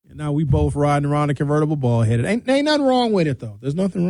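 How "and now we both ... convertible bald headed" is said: ordinary talk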